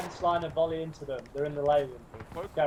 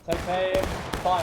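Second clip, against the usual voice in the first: shouting orders over battle; 2 semitones higher, 6 dB louder, slower than usual. Acted. Captain orders volley.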